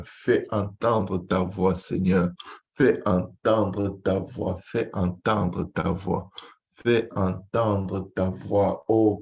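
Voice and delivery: ordinary delivery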